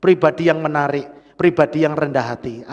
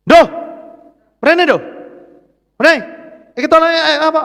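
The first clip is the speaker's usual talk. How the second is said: higher and louder, barking a rough command; harsh rude order; 9 semitones higher, 7 dB louder than usual. Shown as a demonstration. Pastor demonstrating a rude order to Indo, harsh and loud. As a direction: snap at him like a bossy elder. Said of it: "nek saya ngomongnya kasar".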